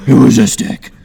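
loud, forceful superhero voice; loud mock-heroic insistence; Batman. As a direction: push it out hard as a caped crimefighter. Batman replies to the Riddler.

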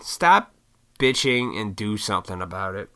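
usual speaking voice

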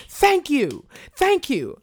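high, loud, clapping thank-you; mother's gleeful thanks. Retold or imitated. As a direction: cheer along like it's long overdue. Mother clapping, agreeing with lady.

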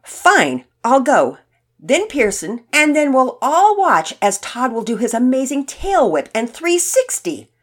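mock his big talk with exaggerated emphasis; sarcastic. A narrator's reading. Finn giving in and mocking Todd's boast, sarcastic. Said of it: "Finn said sarcastically".

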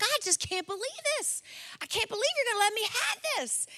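very high, amazed child imitation; kid tripping out. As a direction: gush in mock disbelief like a thrilled boy. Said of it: "tripping out".

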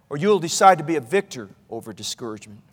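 low, soft second option; hushed resolve. Real, not acted. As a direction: drop the voice deep and quiet to land the choice. Hushed second half of choice.

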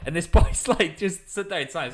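ordinary talk

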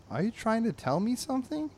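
lightly acted high-pitched question; puzzled owner. Drabble to his barking dog, mildly puzzled and high. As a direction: lift the voice, asking the dog.

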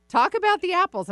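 high, loud quoted voice; high mock voice. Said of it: "I'm like".

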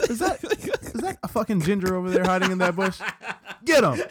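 high, loud monster hunting a ginger. Pretend. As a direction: yell it like a hunting undead soldier.

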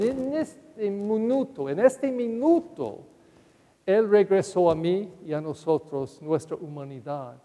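ordinary delivery

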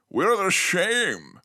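slow, slurred Connery "same" like "shame"; Sean Connery accent. Retold or imitated. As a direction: drag out "We're the same" as "We're the shame". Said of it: "he's all like".

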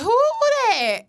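a high, loud, slow nosy mom. Retold or imitated. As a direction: ask like a suspicious mom squinting at a baby photo. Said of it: "she be like".

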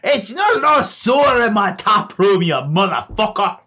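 very high, loud screaming halfling; furious halfling. Acted. Halfling screaming at the thief in his tap room, very high furious yell. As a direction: scream it, outraged.

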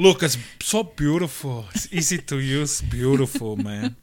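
lightly voiced Cuban gangster accent, slow; a hint of a Cuban-accented gangster; Tony Montana voice. Crazy Russian Hacker showing medieval folk an apple cutter, lightly voiced Cuban accent. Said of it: "I sound more like Tony Montana".

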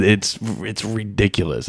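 usual speaking voice

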